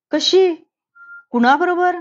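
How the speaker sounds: can't believe their daughter went there; shocked disbelief. A narrator's reading. Parents stunned to hear their daughter was at a rave, asking sharply.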